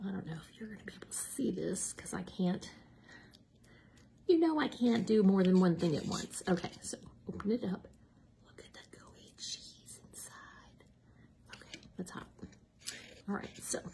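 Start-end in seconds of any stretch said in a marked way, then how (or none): 0.1-2.7 quiet unvoiced whisper. Real, not acted. Whispering while filming the food. 6.5-13.8 whispering. Real, not acted. Whispering while opening the sandwich.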